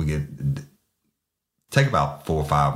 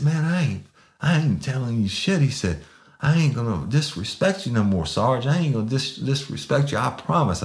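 high, eager pleading promises; pleading suspect; 10 semitones higher than usual. Retold or imitated. Suspect promising the sergeant respect, high and pleading. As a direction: grovel and promise, voice way up.

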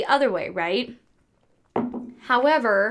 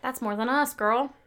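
ordinary talk

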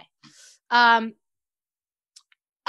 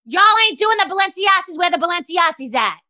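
high, louder mock scolding; hammed-up angry rant; 6 semitones higher, 5 dB louder than usual. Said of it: "she gets really mad and she says".

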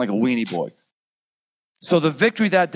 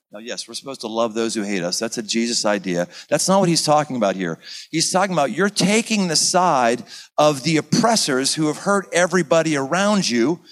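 ordinary talk, nothing marked

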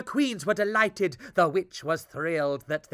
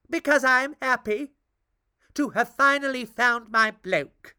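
witch voice; 7 semitones higher, 5 dB louder than usual. Acted. Witch covers her anger.

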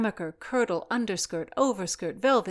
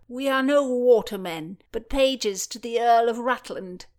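put-on higher, louder boyish call-out; loud, high proclamation; piping up; 6 semitones higher, 7 dB louder than usual. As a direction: pipe up boldly to the crowd. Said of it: "and then piped up".

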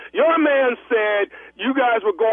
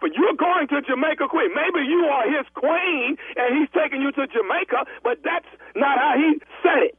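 mocking; 7 semitones higher than usual. Agent taunting the bride about Jamaica, Queens, mocking. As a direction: needle her with a sneering "queen".

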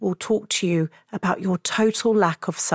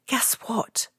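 whispering; playful whisper; sweet nothings; 6 dB quieter than usual. Acted. Tender words to younger self.

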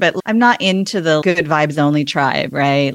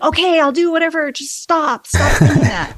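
high, pleading sibling begging to stop; exasperated sibling; 11 semitones higher than usual. Retold or imitated. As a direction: beg them to quit, way up high. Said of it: "it's so annoying that".

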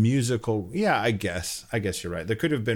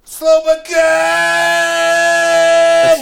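much higher, very loud title shout; shouted announcement; 15 dB louder, much slower than usual. Acted.

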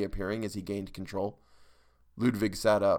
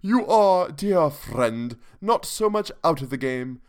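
higher, pompous eulogy voice; higher testy aristocrat; testy count; 4 semitones higher, 4 dB louder than usual. A narrator's reading. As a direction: testily intone the eulogy. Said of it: "the count went on testily".

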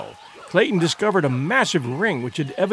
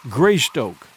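usual speaking voice

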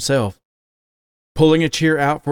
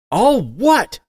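incredulous challenge; 9 semitones higher, 4 dB louder than usual. A narrator's reading. Son challenges father.

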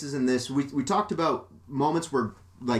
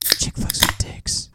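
slow, breathy whispered punchline; mock whisper; 4 dB louder, slower than usual. Summing up the movie plot as a whispered joke. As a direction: hiss the summary like a secret.

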